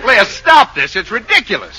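high, loud, frantic protest; exasperated scolding. Acted. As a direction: order your wife to quit the act. Husband scolds wife's antics.

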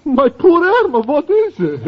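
high, startled worry; rushing in worried; alarmed concern. Acted. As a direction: Professor finds the girls crying. Professor alarmed by crying girls.

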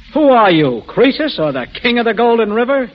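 loud mocking challenge; sarcastic outburst. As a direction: jab at him sarcastically. Mocks Jock's ambitions.

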